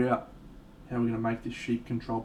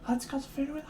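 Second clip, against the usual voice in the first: whisper; 12 semitones higher, 5 dB quieter than usual. Pretend. Whispered line, then said again.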